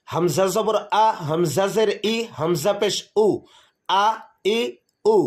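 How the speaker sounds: আ, ই, উ each said short and crisp, no drawing out; short Arabic vowels. Shown as a demonstration. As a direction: clip আ, ই and উ short, the way the harakat are read. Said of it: "জবরের উচ্চারণ বাংলা আকারের মতো হয়". (আ, ই, উ ছোট করে দ্রুত উচ্চারণ)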